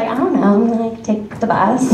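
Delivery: high, coy and shy reply to a flirting stranger. Retold or imitated. Coyly answering the stranger about the bus. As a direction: play it coy, voice up. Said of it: "so coy, like".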